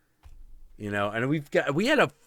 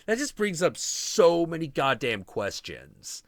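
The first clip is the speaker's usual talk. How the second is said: normal talking voice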